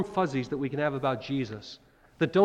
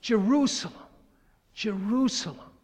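heartbroken cry; 7 semitones higher than usual. Retold or imitated. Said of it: "his heart broken".

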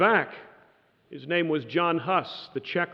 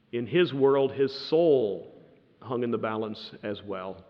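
normal talking voice

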